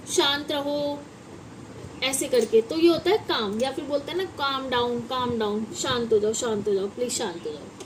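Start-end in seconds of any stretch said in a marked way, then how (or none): 4.2-7.8 calm someone down, quieter each time; soothing. Shown as a demonstration. Teacher acting out calming someone, getting softer. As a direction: lower and softer, fading to a hush.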